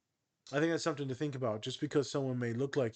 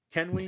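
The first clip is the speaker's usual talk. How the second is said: Can we? asked higher and louder; rising emphatic question; 6 semitones higher, 4 dB louder than usual. Acted. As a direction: push up on Can we?. Rhetorical build, raised voice.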